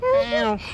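very high loud cat call; high mew. Pretend. Father calling a cat to the sausage, high.

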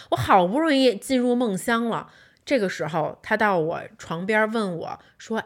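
ordinary talk

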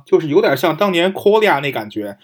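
ordinary talk

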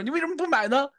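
high, lightly voiced grandma scolding; a hint of a puzzled grandma; grandma asking. Grandma asks the boy why he didn't buy it, high questioning voice. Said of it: "然后我奶就说".

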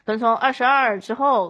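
usual speaking voice

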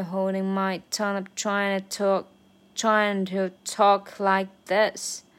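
slowed down, every word drawn out; slow version. Shown as a demonstration. Learner demonstrates the slow practice version. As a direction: take it slowly, word by word. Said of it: "然后是慢速版".

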